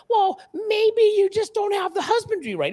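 high-pitched, put-on condescending voice; mocking know-it-all. Facebook professionals blaming the buyer, mocking imitation. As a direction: mimic smug experts in a high sing-song.

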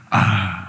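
real, weary exhale; heavy sigh. Real, not acted. Recalling the car crash, heavy sigh. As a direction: let out a long breath.

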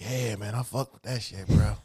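low, soft laid-back mumble; hushed low-key approval; smooth, unexcited guy. Retold or imitated. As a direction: imitate him low and unbothered. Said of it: "That's what he sound like".

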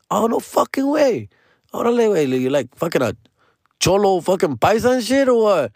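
excited teasing question, high; retold excitement.

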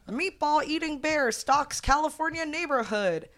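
put-on high old-timey headline voice; newsreel announcer.

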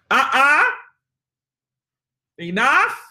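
stern mom voice. Shown as a demonstration. Trainer correcting naughty dogs, stern mom voice. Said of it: "my mom voice or my demon voice".

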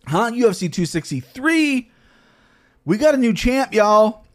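hyped announcement. Real, not acted. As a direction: launch the recap like a ring announcer.

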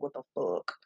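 whispered, low what the fuck; a stunned whisper; shocked whisper. Retold or imitated. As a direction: breathe it out in disbelief. Reacting to crude emojis, whispered.

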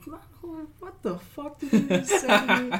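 replaying an insult with fake fury; heated outrage. Student retelling the art teacher's insult, mock outrage. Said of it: "I got so heated".

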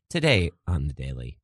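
low news-podcast host intro; affected radio voice. Acted. Parodying The Daily's host announcing today's guest, affected radio voice. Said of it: "one of these radio voices".

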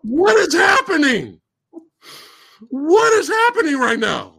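high, stretched-out cry of bafflement; exasperated disbelief. Real, not acted. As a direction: wail it in utter disbelief. Baffled outburst at the fight scene.

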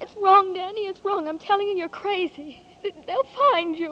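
loud, high, frantic protest; frightened pleading. Acted. Ruth warns Danny off, frightened and loud. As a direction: beg him to stop before he's caught.

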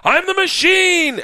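high, drawn-out cry; exuberant boast. Retold or imitated. Comic drinking with gangsters, his only phrase, exuberant. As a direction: belt it proudly, stretched out.

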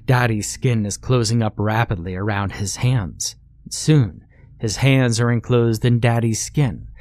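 plain reading voice

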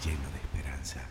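distant, very soft repeat of an affirmation; fading echo. Acted. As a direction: let each repeat recede further away.

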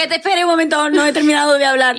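high-pitched, exasperated objection; indignant protest. Real, not acted. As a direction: object to being cut off, voice jumping up high. Mock protest at being hurried along.

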